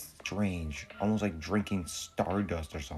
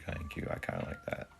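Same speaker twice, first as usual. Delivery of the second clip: soft unvoiced whisper; 4 semitones lower, 8 dB quieter, faster than usual. Real, not acted. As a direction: whisper it to yourself. Whispered aside about cute alien.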